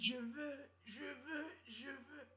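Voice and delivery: high, whining I want; whiny girlfriend. Retold or imitated. Girlfriend begging her boyfriend, high and whiny. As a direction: whine it up high like a needy girlfriend. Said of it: "Elle dit".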